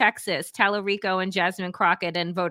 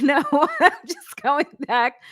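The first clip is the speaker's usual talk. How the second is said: laughing; 5 semitones higher than usual. Retold or imitated. Laughing reply to Uber driver.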